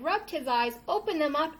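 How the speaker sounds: normal talking voice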